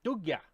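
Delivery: "Dugga" pitched high, as doog-yah, falling; copying Swedish pronunciation. Shown as a demonstration. Learner repeating after a translator, high "Dugga" as doog-yah. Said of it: "How do you say that?".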